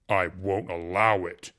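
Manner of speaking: loud, slow and hollow. A narrator's reading. Jealous husband warning the uncle off, loud and hollow. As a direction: slam the words down like a fist. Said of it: "said in a hollow voice".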